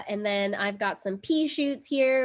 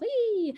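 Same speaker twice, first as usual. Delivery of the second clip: excited squeal; 13 semitones higher than usual. Real, not acted. Excited squeal about new skateboard.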